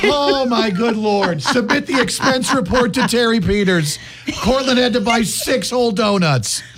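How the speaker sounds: high-pitched, hammed-up exasperation, dragging the opening; mock outrage. Radio host teasing co-host over paying for donuts, mock outrage. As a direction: make a big show of being appalled.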